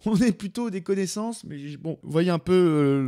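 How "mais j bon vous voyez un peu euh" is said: ordinary delivery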